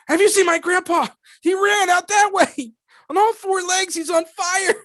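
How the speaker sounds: very high, loud frantic cry; a villager desperately searching for grandpa; frantic high-pitched panic; panicked walla. Shown as a demonstration. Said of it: "freaking out trying to find my grandpa".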